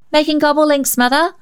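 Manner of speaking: hope she'll understand; girl explaining the ink spill to her mother, hopefully; hopeful child. A narrator's reading. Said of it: "Rhonda explained hopefully".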